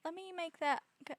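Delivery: high, sing-song pet talk. Real, not acted. Apologizing and soothing pet.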